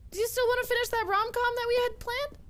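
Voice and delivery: usual speaking voice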